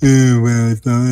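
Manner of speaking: hammed-up sulky Eeyore sigh; exaggerated gloomy teen. Shown as a demonstration. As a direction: overdo the mopey teenager. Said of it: "I have to be more exaggerative with it".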